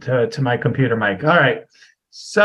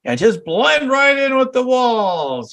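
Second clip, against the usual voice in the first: Mister Invisible voice; 11 semitones higher, slower than usual. Retold or imitated. Mimics invisible self.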